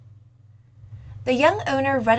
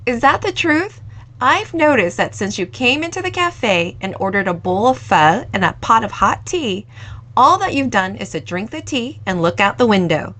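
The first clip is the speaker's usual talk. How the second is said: put-on teasing young owner, louder; louder, coy teasing from the young owner; coy banter; 9 dB louder than usual. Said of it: "coyly bantered back".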